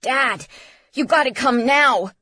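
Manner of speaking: loud, high-pitched urgency; frantic teenage son; panicked. A narrator's reading. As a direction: plead for help in panic. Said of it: "At the panic in his son's voice".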